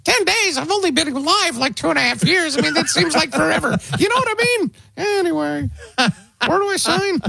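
put-on very high little-kid voice; squeaky indignant toddler, put on; four-year-old gun buyer. Small child at gun store facing a wait, squeaky indignation. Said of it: "that is Joe Getty's".

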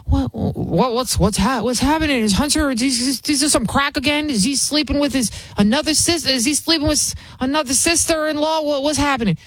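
a frantic mock Biden fearing the worst; flustered Joe Biden. Hearing bad news from Jill, mock panicky Biden. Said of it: "And she's like".

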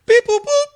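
high-pitched robotic beep boop; scanner beeps. Retold or imitated. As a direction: chirp it like a machine scanning a face. Imitating a face recognition scanner.

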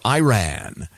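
last "Iran" as eye-RAN; eye-RAN pronunciation. Shown as a demonstration. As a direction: start the last "Iran" like "eye", end like "man". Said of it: "some people who say Iran or".

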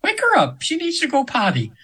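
a high, airy angel giving orders kindly; angel's voice. Retold or imitated. Angel tells host to wake client, high and airy. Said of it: "I'm almost using her voice now".

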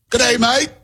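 high-pitched playful Australian greeting; mock Australian accent. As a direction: put on a high Aussie accent. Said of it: "That's for our Australian friends".

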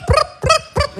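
very high popping prup sounds; silly popping noise. Retold or imitated. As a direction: pop it out like a toy. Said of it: "like it's fun".

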